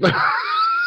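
high-pitched burst of laughter; squealing amused laugh; real laugh. Real, not acted. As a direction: crack up at a friend's attempt. Laughs at failed pronunciation.

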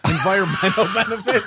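incredulous laughing. Real, not acted. Co-host doubting the environmental benefits, laughing incredulously. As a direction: echo it back through real laughter.

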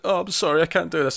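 Anthony. Retold or imitated. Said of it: "he's getting really upset".

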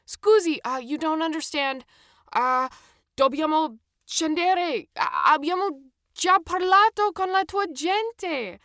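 loud, high, halting foreigner's Italian; awkward broken Italian. A narrator's reading. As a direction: plead slowly in clumsy Italian, groping for words. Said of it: "extremely awkward and broken Italian".

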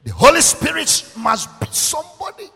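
loud, high preacher's proclamation; forceful preaching. Real, not acted. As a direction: proclaim it to the congregation at full voice.

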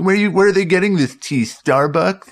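high, showy monologue joke; Capote-style Leno. Retold or imitated. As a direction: deliver the punchline as a fey talk-show host. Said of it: "Truman Capote hosting The Tonight Show".

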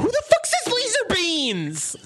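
high, shocked rant; mock-furious high disbelief; incredulous outrage. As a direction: blow up at the absurd slip. Outraged about the slip.

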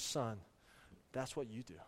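a quiet word from God; voice of the Lord. Retold or imitated. The Lord gently corrects the father, softly. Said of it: "the Lord spoke to me".

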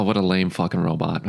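usual speaking voice

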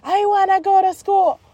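very high mock wail; crying child. Daughter cries to mom for school, whining. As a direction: whine it out like a sobbing kid.

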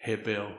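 "Hevel" as HEH-vel, low and soft; Hebrew pronunciation. Shown as a demonstration. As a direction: softly repeat the Hebrew word "Hevel". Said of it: "how to pronounce it in Hebrew".

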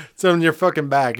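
ordinary delivery

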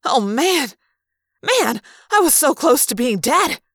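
high, loud exclaiming; excited outburst. Acted. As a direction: burst out in amazed relief at being alive. Revived, exclaims over near death.

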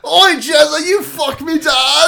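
high, loud British heckler; hammed-up British yelling; mock British accent. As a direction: shout it like a rowdy Brit. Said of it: "let me put on a British accent".

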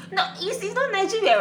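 high, excited correction; emphatic correction. Real, not acted. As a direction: set the record straight eagerly.

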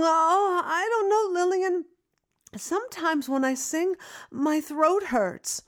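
put-on high, plaintive young voice; whiny young self. Young singer complaining to her teacher, high and whiny. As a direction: sound small and unsure.

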